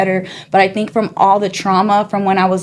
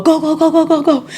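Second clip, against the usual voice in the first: higher, hurried mock urging; urgent command; 9 semitones higher than usual. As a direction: rush the getaway order. Uncle urging escape from robbery.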